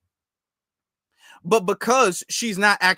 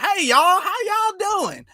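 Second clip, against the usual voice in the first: high, drawn-out Southern greeting; Southern accent; 10 semitones higher, slower than usual. Acted. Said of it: "don't sound like a Southern accent".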